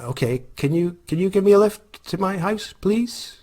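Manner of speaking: nervous request. Retold or imitated. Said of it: "I'm like".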